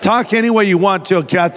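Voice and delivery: ordinary talk, nothing marked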